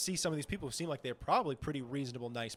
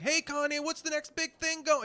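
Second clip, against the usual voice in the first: much higher, cheesy pushy reporter voice; mock paparazzi; 12 semitones higher than usual. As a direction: needle him with a fake-bright question. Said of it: "the dude's like".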